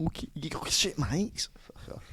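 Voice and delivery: lightly voiced Scouse accent; a hint of Scouse accent; Scouse accent impression. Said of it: "that's my impression of Julia".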